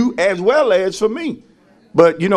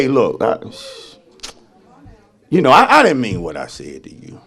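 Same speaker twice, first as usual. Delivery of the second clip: mock apology; 8 semitones lower than usual. Someone who spoke while heated apologizing, voiced lower. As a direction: play the one backing down, lightly.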